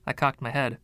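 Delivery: ordinary delivery, nothing marked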